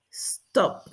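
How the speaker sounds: "stop" alone, ending on a released P; final P demo. Shown as a demonstration. Teacher shows students the last sound, "stop" with clear P. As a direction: pop the P closing "stop". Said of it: "Termina en P".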